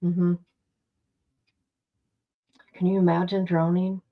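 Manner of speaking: ordinary talk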